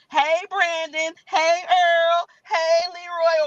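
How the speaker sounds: put-on hailing old friends by name; calling out. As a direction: call out to friends outside. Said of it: "I'm always like".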